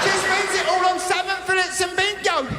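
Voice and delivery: hammed-up pleading kids; mock kids. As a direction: beg like a neglected child. Kids telling on mum.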